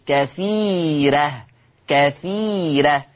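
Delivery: كثيرة drawn out very slowly with a light ra; teacher slowly modelling كثيرة; slow thin ra. Shown as a demonstration. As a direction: stretch كثيرة, ra kept thin. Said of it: "يبقى حكم الراء الترقيق".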